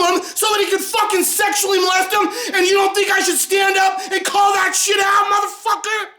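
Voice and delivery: high, raging yell; furious shout. Real, not acted. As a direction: tear into the critic. Furious reply to a critic.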